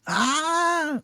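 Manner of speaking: high, drawn-out mock cry; imitated wail. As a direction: imitate someone wailing on one long note. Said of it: "uns choram que parece que não vai parar". (choro imitado, agudo e prolongado)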